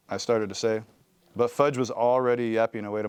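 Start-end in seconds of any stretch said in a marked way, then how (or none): none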